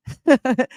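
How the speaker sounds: amused burst of laughter; delighted laugh; short laugh. Real, not acted. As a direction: laugh out loud at the line. Laughs at the line.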